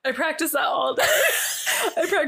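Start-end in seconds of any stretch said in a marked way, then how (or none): 1.1-2.3 said through high laughter; laughing, higher and slow. Real, not acted. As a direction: giggle the words out slowly. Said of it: "That's not fair".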